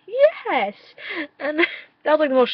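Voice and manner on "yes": much higher, shrieked yes; excited squeal. Retold or imitated. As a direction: shriek it at the screen. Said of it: "I'm like".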